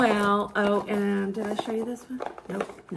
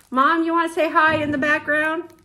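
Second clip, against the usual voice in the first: calling out; 5 semitones higher, 3 dB louder, faster than usual. Real, not acted. Calling to Mom in another room.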